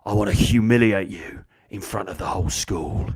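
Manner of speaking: low, theatrical bully's inner voice; menacing bully. Acted. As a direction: play the bully savouring his threat. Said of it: "That's what he's thinking".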